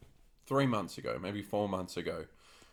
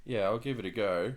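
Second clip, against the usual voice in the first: usual speaking voice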